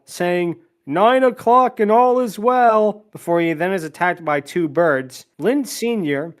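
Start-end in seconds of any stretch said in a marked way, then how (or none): 0.9-3.0 higher, slow, drawn-out crier's call; town crier. Acted. Bell-ringing man announcing nine o'clock, town crier call. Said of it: "A man rings a fallen bell".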